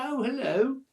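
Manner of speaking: high, loud put-on hello; imitated girl's greeting. Bedraggled girl to camper next day, imitated greeting. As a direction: mimic the girl's greeting, pitched well up. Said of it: "She said".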